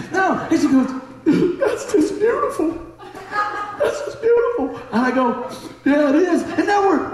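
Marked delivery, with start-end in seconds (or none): 1.6-4.8 very high, drawn-out weepy squeal; mock tearful woman. Crying woman moved by his sharing, hammed-up high sobby voice. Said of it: "the woman starts crying". 5.8-6.4 high, mock-tearful agreement; mock crying. Answering the crying woman by the cards, high mock crying. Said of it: "we're crying over there".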